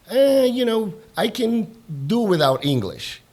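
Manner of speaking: dismissive quote. Retold or imitated. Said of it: "there is still that stigma against".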